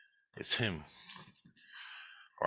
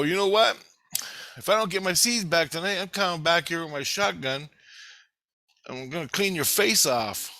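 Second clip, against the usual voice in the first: higher-pitched, worked-up warning; retold threat; 7 semitones higher than usual. Intruder warns scientist to return seeds, worked-up threat. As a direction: lay down an ultimatum, re-enacted. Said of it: "so I threatened him".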